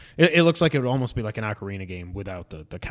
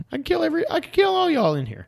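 much higher, strained villain Superman; mock evil Superman; faster than usual. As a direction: ham up Superman losing it. Superman turning evil, voiced.

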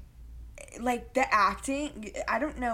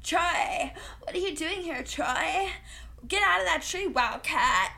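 higher, hammed-up mock of her line reading; weird Vanessa imitation; 8 semitones higher, 4 dB louder than usual. Mocking the actress's repeated weird Troy line, higher and louder. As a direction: overdo the odd way she says Troy and Wildcat. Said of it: "talks like that".

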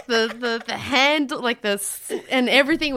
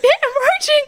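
high, louder hushed alarm; 13 semitones higher, 5 dB louder than usual. Retold or imitated. Peeing woman hears a couple coming, high mock alarm. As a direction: warn yourself in mock panic. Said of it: "but I was like".

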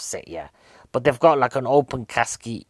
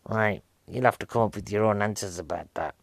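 ordinary delivery

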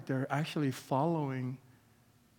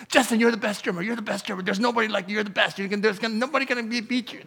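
higher, eager pump-up patter; imitating hype man; 6 semitones higher, 4 dB louder, faster than usual. Hype man pumping up Justin the drummer, higher and eager. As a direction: gas up the drummer like a fight corner. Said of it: "somebody comes next to you and it's like".

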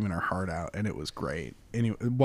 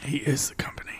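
dramatic hushed whisper; 3 semitones higher, 5 dB quieter than usual. Acted. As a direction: echo it as a secret. Echoing the dramatic aside.

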